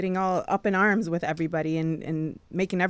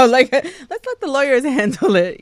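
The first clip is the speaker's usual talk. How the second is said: laughing through it; 6 semitones higher, 10 dB louder than usual. Real, not acted. Laughing while telling the story.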